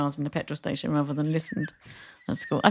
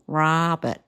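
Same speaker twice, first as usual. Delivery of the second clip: imitated Canadian R in Robert; kids' new Canadian accent. Mum quoting her kids' changed accent, imitated Canadian. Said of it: "I heard their accents change".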